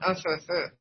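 unable to talk. Shown as a demonstration. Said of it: "That's the way I could talk".

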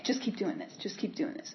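unvoiced, soft self-reminder; whispered self-encouragement; whispered to herself. Real, not acted. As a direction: whisper it to calm yourself. Reassuring herself.

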